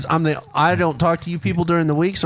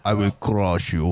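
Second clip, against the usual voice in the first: low, slow, menacing Russian boxer voice; slow deep Ivan Drago threat; Dolph Lundgren impression; 9 semitones lower, 4 dB quieter, slower than usual. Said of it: "Your Dolph Lundgren impression".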